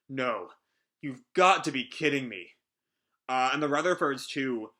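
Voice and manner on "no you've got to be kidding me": ordinary delivery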